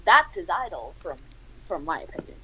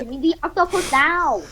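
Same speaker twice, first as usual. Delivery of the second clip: Gordon Ramsay; 6 semitones higher than usual. Acted. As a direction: demand it in a high put-on voice. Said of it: "Gordon Ramsay says".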